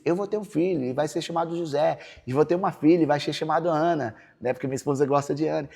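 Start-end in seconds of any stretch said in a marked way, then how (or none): 0.0-4.2 higher, louder proclaiming from the pulpit; preaching. Retold or imitated. Pastor proclaiming to the church a son named José, preaching voice. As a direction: declare it like a preacher sure of God's promise. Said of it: "Eu falei isso".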